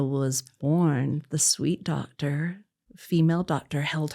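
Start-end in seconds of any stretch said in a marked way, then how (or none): none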